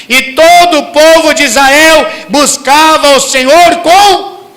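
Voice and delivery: loud, high, fervent sermon delivery; full-force preaching. Acted. Preacher rousing the congregation, full-force preaching. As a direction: preach it out loud to the whole church.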